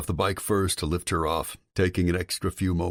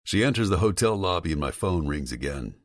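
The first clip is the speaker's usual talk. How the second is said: ordinary talk, nothing marked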